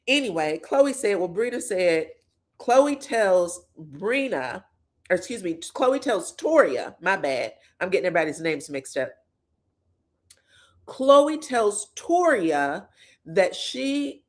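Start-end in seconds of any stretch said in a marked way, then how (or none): none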